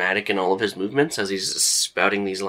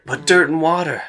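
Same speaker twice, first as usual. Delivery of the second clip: higher, lightly theatrical relish; intrigued actor; 7 semitones higher than usual. Acted. Actor defending archaeology to bored visitors, with mild theatrical wonder. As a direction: make mere dirt sound wondrous. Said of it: "he's making it sound cooler than it is".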